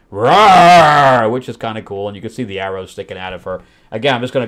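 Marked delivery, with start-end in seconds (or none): 0.1-1.3 loud, drawn-out mock roar; big hammed-up beast roar; monster roar. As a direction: imitate a monster roaring off the page. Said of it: "two-page monster spread".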